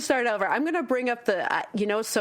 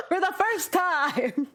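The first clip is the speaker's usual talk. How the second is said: laughing; 8 semitones higher, slower than usual. Real, not acted. Laughing at innuendo.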